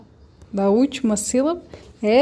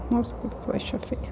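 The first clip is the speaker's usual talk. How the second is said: very soft, whispery self-talk; muttered aside; 9 dB quieter than usual. Real, not acted. Teacher commenting on her ugly arrow, muttered aside. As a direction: murmur it under your breath.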